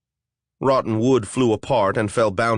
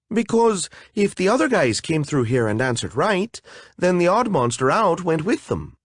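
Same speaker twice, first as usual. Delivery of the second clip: nervous fairy; 7 semitones higher than usual. Acted. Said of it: "nervously fluttered her wings".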